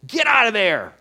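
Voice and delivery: high, far-off mock yell at a kid; imitating a gruff stranger yelling; retold yell. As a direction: bark it like a cranky old player. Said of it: "just yelled at him".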